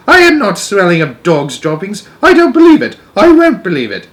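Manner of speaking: loud, high indignant protest; child's high, loud outrage; outraged protest. A narrator's reading. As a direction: refuse to believe it, loudly. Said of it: "I cried".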